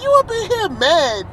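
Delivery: high, loud disbelief; incredulous. Real, not acted. Co-host scolding his friend for doubting the new lineup, incredulous. Incredulous at co-host's objection in argument.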